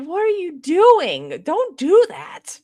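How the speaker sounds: high, drawn-out exasperated protest; mock exasperation. Scolding a candidate for the fake accent, mock exasperated.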